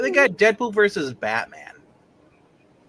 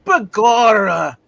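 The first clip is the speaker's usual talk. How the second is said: Irish cop; 5 semitones higher, 8 dB louder, much slower than usual. Acted.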